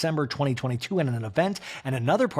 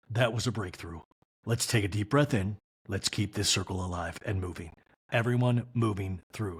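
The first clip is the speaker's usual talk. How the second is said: soothing therapist; 6 semitones lower, 3 dB quieter than usual. Retold or imitated. Voicing the circle's therapist.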